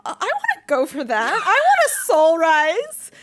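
high, loud, eager squeal; excited. Retold or imitated. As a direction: gush like a thrilled fan. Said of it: "just hearing it, I'm like".

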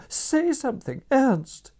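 high, loud pleading call of his name; imploring cry. A narrator's reading. Wife calling her husband through the door, loud and imploring. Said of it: "she implored".

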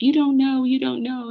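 high, pleading comeback; retold defensive protest. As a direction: protest over her, pitch jumping up. Retold protest to scolding friend.

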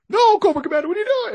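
high, loud protest; mock alarmed cry. Onlooker protesting as the prisoner is freed, high mock alarm. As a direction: cry out as if to stop him. Acting out alarm at chains released.

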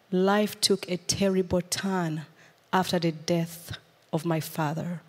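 usual speaking voice

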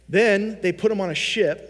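usual speaking voice

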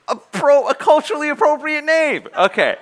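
high, loud laughing correction; squealing with amusement at his own joke; laughing aside. Real, not acted. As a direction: laugh through the self-correction. Laughing at his own made-up name.

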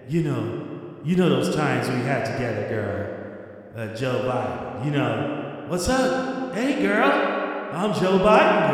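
mock Biden. Host keeps mocking Biden, low leering old-man voice. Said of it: "You, you hear this guy?".

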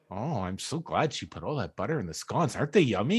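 low, soft exaggerated politeness; mock polite guest. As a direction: imitate a sweetly gushing guest. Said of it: "they probably all went".

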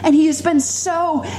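high, fervent preaching climb. Acted. Preacher testifying to the congregation, rising fervent preaching. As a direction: testify with growing fervour.

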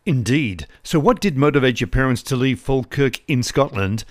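ordinary talk, nothing marked

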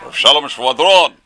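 loud, slow, gruff impression of an old preacher. Teacher imitating the rabbi heard in the dark, loud and gruff. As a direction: boom it out slowly and gruffly. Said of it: "He had a very gruff voice".

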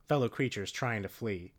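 normal talking voice

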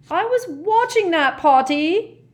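high, loud, drawn-out poltergeist whine; indignant poltergeist. Acted. Peeves complaining to Harry about the fight, indignant. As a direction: complain loudly in a shrieky, put-on voice. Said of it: "he told Harry indignantly".

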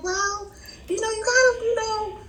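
high, softened voice of a tactful friend; friend's careful advice. Retold or imitated. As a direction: imitate a friend gingerly calming someone. Friend gently advising calm, voiced.

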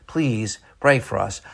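ordinary talk

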